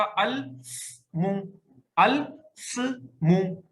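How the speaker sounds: अल स्मु drawn out very slowly, both letters silent; two sakin sounds. Shown as a demonstration. Teacher shows students the wrong clash: slow अल स्मु. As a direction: stretch अल स्मु to show the clash. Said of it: "तो अब क्या बचेगा?".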